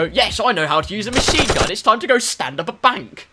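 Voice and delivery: high, loud, overconfident player; hammed-up eager boasting; mock gung-ho gamer. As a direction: announce it like a deluded gamer. Mock deluded Fortnite player.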